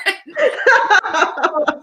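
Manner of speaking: bright burst of laughter; real laugh. Real, not acted. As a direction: laugh it off, amused. Laughs at own story.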